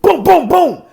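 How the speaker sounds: loud, high booms; punchy imitated blows; punch sound effects. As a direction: fire off the hits with force.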